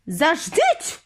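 high-pitched cry to halt; mild piercing yelp; sudden shriek. A narrator's reading. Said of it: "вирискнула раптом одна з відьом".

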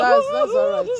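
choking up through tears, voice cracking high; real tears breaking the words; crying. Real, not acted. Distressed woman in tears.

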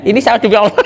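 normal talking voice

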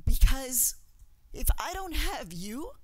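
fighting back tears while pleading; choked-up plea to the artists; emotional. Real, not acted. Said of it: "And yeah, it's emotional".

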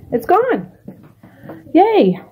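delighted squeal of relief; excited cheer. Real, not acted. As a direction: celebrate that it's gone. Garbage gone, cheering.

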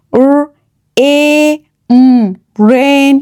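র, এ, উম one sound at a time, then রেন blended; long 'ay' in এ, pauses between sounds, then রেন. Shown as a demonstration. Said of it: "এ long sound এ তৈরি হয়". (ধ্বনি ভেঙে ভেঙে, তারপর জুড়ে)

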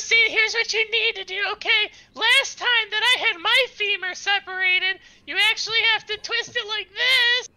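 very high, loud squeaky voice; chirpy know-it-all in a high squeak; Rupert's voice. Acted. Rupert showing nurses how to set bones, high squeak. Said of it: "He goes".

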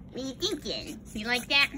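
ordinary delivery

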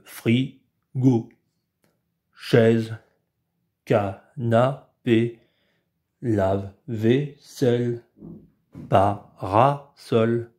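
slow, syllables cut apart; name each object like a robot. Shown as a demonstration. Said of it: "donner leur nom en parlant comme un robot". (voix de robot, mots hachés)

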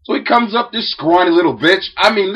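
normal talking voice

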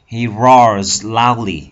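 slow, loud, deliberate reading; slow teacher read. Shown as a demonstration. Teacher reads the roar sentence to the class, slow and loud. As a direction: spell out each word slowly and loudly. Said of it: "reading example sentence aloud".

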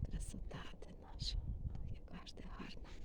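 tender whisper to the cat. Real, not acted. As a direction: breathe it softly to the cat. Cooing whispers to her cat.

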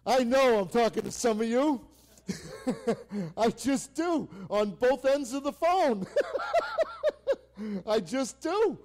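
loud laughing preacher. Real, not acted. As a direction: laugh it out, can barely get the words through. Laughing through the line.

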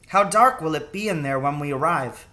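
put-on a young son, keen and ready; boy's voice. A narrator's reading.

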